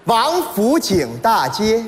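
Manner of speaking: very slow, measured place name; navigation voice. Retold or imitated. Standard sat-nav reading out destination, slow measured voice.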